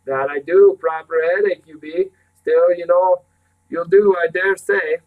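loud country housekeeper; teasing scold. Acted. Housekeeper answering the indignant boy, loud teasing scold. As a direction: scold heartily, with mock severity.

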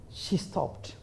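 ordinary delivery

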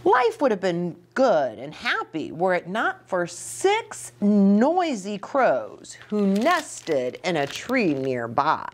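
usual speaking voice

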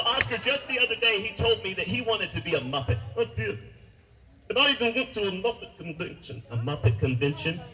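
ordinary talk, nothing marked

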